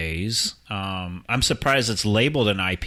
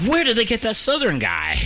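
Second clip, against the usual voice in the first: imitating a Southern listener, pitched up; mock Southern accent; 12 semitones higher, 4 dB louder than usual. Mocking a Southern listener, Southern accent. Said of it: "people in the South and be like".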